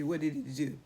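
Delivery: plain reading voice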